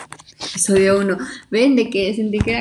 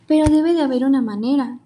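desperate princess; 5 semitones higher, 5 dB louder than usual. Acted. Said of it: "dijo Dai desesperada".

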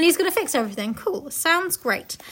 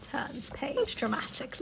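soft unvoiced aside; whisper; 9 dB quieter, slower than usual. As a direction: whisper the stage direction. Whispered stage direction.

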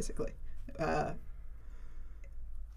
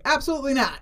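higher, louder mock-indignant refusal; emphatic Sicilian refusal; 7 semitones higher, 5 dB louder, faster than usual. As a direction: refuse loudly as an offended local would. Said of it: "Sicilians would say".

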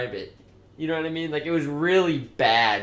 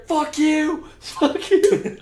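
high, loud mock scream; 12 semitones higher, 5 dB louder, much slower than usual. Friend imitating Julia screaming down at people below, loud. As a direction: yell down at strangers. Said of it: "we just started hearing".

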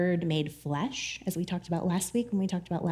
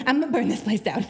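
movie quote. Retold or imitated. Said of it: "If you've watched Office Space".

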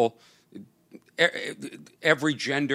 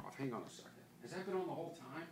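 away from the mic; 27 dB quieter, faster than usual. Real, not acted. Walks off to shut air conditioner.

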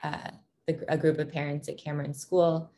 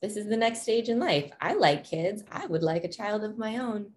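higher-pitched voicing of a naive earlier thought; past self thinking; 7 semitones higher than usual. Parent recalls thinking about having a child, imitated higher-pitched musing. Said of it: "thinking, like".

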